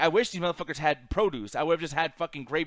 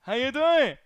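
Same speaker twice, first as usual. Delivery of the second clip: higher, eager imitation of the worker; concession worker greeting; 7 semitones higher, 4 dB louder, slower than usual. Worker recognizing a regular customer, mock excited greeting. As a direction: mimic his greeting in a raised, swooping voice. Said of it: "now he's knowing me like".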